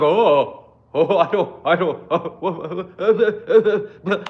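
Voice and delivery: flustered mock stammer with a laugh. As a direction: play them fumbling for words. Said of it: "they're like".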